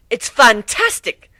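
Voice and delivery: high and drawn out, theatrical; dramatic declaration. Acted. Rarity admiring the new armor, high and dramatic. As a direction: declare it like a fashionista gushing. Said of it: "declared dramatically".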